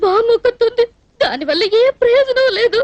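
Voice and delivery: tearful lament; weepy, self-pitying grievance; crying. Acted. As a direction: sob out a helpless complaint. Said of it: "ఎందుకు ఏడుస్తున్నట్టు ఇప్పుడు నువ్వు?".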